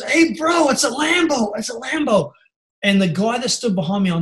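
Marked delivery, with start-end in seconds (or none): 0.0-2.3 high, hyped-up imitation of a car fan; exaggerated excited pal, pitched way up; pumped bro. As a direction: spread the news to your mate, mock-thrilled. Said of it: "They were really pumped about this".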